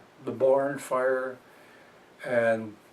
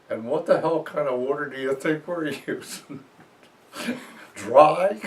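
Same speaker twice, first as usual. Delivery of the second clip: sarcastic retort; 4 semitones higher than usual. Retold or imitated. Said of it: "as only Art Lee could have done".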